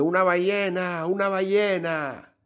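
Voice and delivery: excited mock shout. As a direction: call out a whale from the pier.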